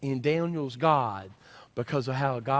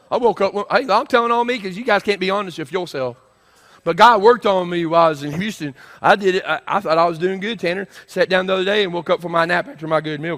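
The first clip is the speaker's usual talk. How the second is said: raised, forceful confession from the pulpit; loud preaching; 6 semitones higher, 10 dB louder than usual. Real, not acted. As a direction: own up loudly to the congregation. Preaches at the congregation.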